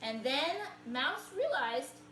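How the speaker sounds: put-on lively picture-book read-aloud for kids; reading to children. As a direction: tell it like a storybook to little listeners.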